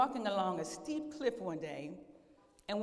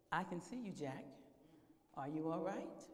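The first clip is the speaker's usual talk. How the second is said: soft, low-key divine reassurance; voice of the Lord; 4 semitones lower, 9 dB quieter than usual. Acted. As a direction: check on him gently from above. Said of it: "I'm the Lord".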